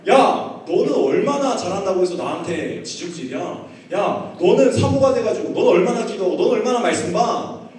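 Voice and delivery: loud, high scolding, acted out; exploding in anger. Husband explodes at wife's criticism, angry scolding. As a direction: lash back, reenacting a furious husband. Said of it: "이제는 막 폭발한 거예요".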